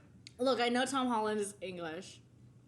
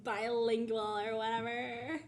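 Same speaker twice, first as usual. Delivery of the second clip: put-on valley girl; 3 semitones higher than usual. Gushing about crush to annoy co-host, valley girl accent. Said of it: "you sound like a valley girl".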